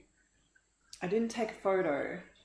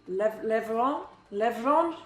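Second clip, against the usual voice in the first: fumbled French accent; slower than usual. Shown as a demonstration. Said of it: "I can't do the she part".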